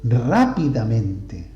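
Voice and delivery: Rápidamente said very slowly, stress on RÁ; teacher dictating Rápidamente; slow example word. Shown as a demonstration. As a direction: stretch out Rápidamente syllable by syllable. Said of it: "tercera y en la cuarta posición".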